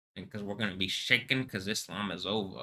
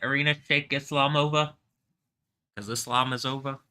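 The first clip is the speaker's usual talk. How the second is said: higher, playful put-on voice; silly voice; 5 semitones higher, 4 dB louder than usual. Comic inviting Irina Shayk to join in, silly voice. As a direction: goof it up, pitched higher. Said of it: "I do it with this silly voice".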